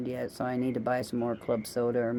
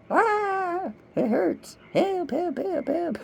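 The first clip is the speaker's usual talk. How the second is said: high, squealing cries of pain; mock-wail for help like someone covered in ants; ant-bitten victim; slower than usual. Woman acting out ant bites, high hammed-up cries for help. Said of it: "I say that pretty good".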